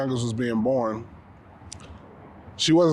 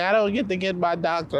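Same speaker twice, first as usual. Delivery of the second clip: mock upset wife; 5 semitones higher than usual. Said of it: "she was mad because she's like".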